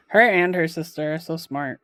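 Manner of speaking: usual speaking voice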